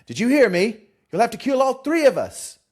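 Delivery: high, loud insistent yell; doubling down, yelling. Acted. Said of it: "Ron doubles down".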